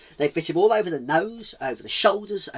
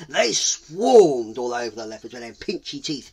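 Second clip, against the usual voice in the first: plain reading voice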